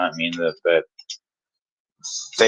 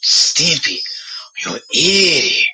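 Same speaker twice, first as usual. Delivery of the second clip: shrill, higher, crying cartoon yell; silly clown; 7 semitones higher, slower than usual. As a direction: wail it like a cartoon. Cartoon quote as a joke.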